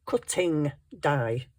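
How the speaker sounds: cutting die said slowly, leaning on cutting; emphasis on cutting. Shown as a demonstration. Crafter joking to viewers about the cutting die, slow stressed cutting. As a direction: draw out cutting die and hit cutting. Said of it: "the emphasis on the word".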